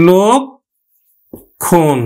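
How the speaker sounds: লক্ষণ very slowly as lok, pause, khon; split syllables. Shown as a demonstration. Teacher breaking the word down, slow lok... khon. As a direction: break লক্ষণ in two with a round o. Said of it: "তাহলে আমরা উচ্চারণ করবো কিন্তু".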